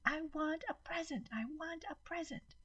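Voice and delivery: put-on high, far child's scream, trailing off; a tantrum on the floor. A narrator's reading. Maddie screaming for a present on the floor, high child voice.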